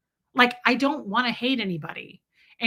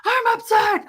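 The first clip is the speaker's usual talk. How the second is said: high, loud hammed-up outburst; exaggerated upset shout, pitched way up; mock yelling; 11 semitones higher, 7 dB louder than usual. Shown as a demonstration. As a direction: yell it as an over-the-top meltdown. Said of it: "I wasn't yelling".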